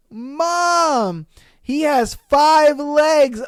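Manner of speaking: lightly voiced kid calling out, drawn out; tiny child yelling. Boy on safari truck calls to his mom, mock child yell. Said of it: "a tiny voice from the back of the truck yell".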